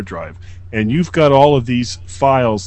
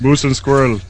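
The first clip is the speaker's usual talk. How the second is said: put-on Russian accent. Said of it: "there's some old school for you".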